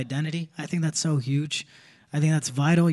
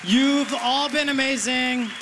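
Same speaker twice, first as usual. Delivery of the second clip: pitched well up, beaming at the crowd; high, excited thank-you to the audience; sign-off to the crowd; 8 semitones higher than usual. Real, not acted. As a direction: thank the room on a high bright lift. Comic thanks the crowd, signing off.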